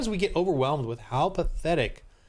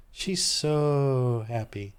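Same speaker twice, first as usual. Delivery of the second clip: mock gushing; 3 semitones lower, much slower than usual. Mocking faces on screen.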